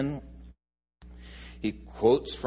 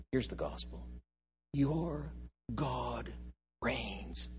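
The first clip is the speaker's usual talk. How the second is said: hushed declaration; 8 dB quieter, slower than usual. Shown as a demonstration. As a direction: say it softly, almost confiding. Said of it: "as apt to say".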